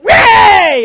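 sudden high loud whoop; imitated sports yell; mock cheer. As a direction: whoop like a fan at a game. Imitating himself cheering at game.

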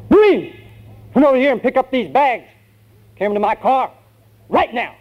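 loud, high-pitched yelled command; drill sergeant. Acted. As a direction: holler orders like a drill sergeant. Sergeant yells at former recruit.

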